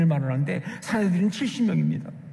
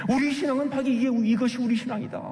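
raised-pitch declaration of shared faith; 자부심; 6 semitones higher than usual. Retold or imitated. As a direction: voice the early believers' pride, pitched up. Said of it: "하는 그 자부심". (자부심에 차서 높은 톤으로 선언하듯)

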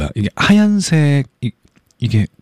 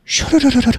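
high squeaky fizz noise; high melting sound; 11 semitones higher than usual. Retold or imitated. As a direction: imitate something melting, up high. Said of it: "이렇게 녹는 것 같아".

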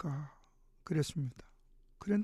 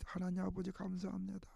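softly breathed thanks to God, barely above a murmur; hushed prayer; 9 dB quieter than usual. Retold or imitated. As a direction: pray it quietly, overwhelmed. Mother thanks God in prison visit.

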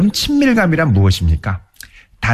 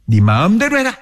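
angry cutoff; 7 semitones higher than usual. Retold or imitated. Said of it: "거기에 분노와 단절이 숨어 있습니다".

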